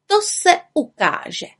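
high, drawn-out character voice; slow, doubting witch; sceptical retort. A narrator's reading. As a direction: stretch the retort out slowly and high. Bimbula answers the challenge.

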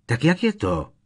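high, loud impatient demand. A narrator's reading. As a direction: demand an answer now. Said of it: "dodal netrpělivě".

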